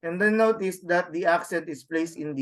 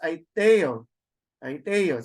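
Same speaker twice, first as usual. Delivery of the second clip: "Aiteo" quick, te still accented; very fast reading; slower than usual. Shown as a demonstration. Teacher showing normal reading speed, Aiteo quick with te stressed. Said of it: "you read it very fast".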